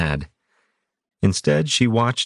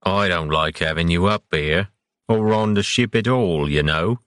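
a grumbling complaint; grumbling old captain; grousing. A narrator's reading. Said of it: "Captain Rangelord groused".

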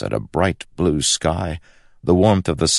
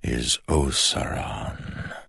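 slow, soft otherworldly whisper; dark whisper behind you; 5 dB quieter, much slower than usual. Acted. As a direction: breathe the name like a shadow. Shadow god speaks its name.

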